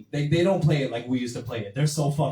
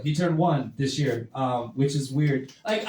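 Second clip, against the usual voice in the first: ordinary talk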